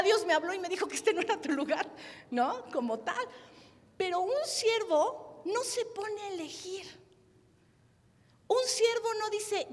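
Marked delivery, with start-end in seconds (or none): 4.0-9.7 emphatic preaching. Acted. Predica con énfasis.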